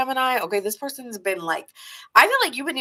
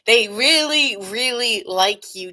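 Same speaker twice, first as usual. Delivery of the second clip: both REALLYs leaned on hard; stress on REALLY; 2 semitones higher, 4 dB louder, slower than usual. Shown as a demonstration. Reader making sure Gemini gets it, REALLY stressed. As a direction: drive home REALLY, REALLY, stretching it out. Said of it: "if you don't get it, let me say".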